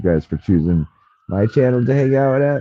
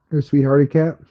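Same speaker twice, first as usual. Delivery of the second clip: cooing to the cat; 5 semitones higher than usual. Real, not acted. Praising the cat.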